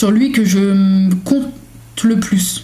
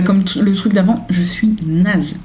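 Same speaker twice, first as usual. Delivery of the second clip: ordinary delivery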